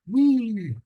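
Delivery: wee squealed high and loud like on a ride; cartoon-style thrill squeal of wee; excited wee. Shown as a demonstration. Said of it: "the excitement when you're at some ride".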